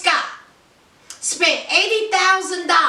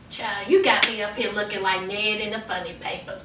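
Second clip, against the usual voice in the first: muttered aside; 7 semitones lower, 6 dB quieter, faster than usual. Real, not acted. Repeats the line under her breath.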